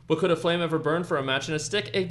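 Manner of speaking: poem reading. Acted. Reading Tim Burton's Stick Boy poem to co-hosts, playful recital. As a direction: read it like a bedtime rhyme.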